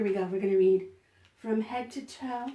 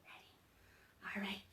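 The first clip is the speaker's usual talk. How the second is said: whisper; 5 semitones lower, 18 dB quieter than usual. Real, not acted. Whispered aside.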